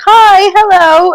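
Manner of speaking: high, slow drawn-out greeting; coaxing a shy animal with a long hello; eager hi hello. Pretend. Player coaxing the creature, slow high hello. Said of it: "just being like".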